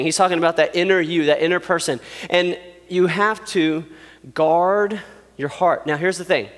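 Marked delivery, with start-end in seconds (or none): 4.3-5.9 slow, deliberate "guard your heart"; slow emphasis. Acted. Preacher driving home the lesson, slow and deliberate. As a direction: press the point slowly. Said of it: "preacher emphasis".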